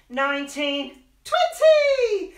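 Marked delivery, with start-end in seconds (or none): 1.2-2.3 high loud cheer; excited shout. Acted. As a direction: burst out at the end of the count. End of counting to children.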